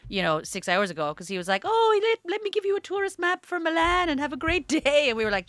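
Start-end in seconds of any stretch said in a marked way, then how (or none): 1.6-5.0 high, bright, helpful host voice; cheery receptionist. Retold or imitated. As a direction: hand over the map all smiles.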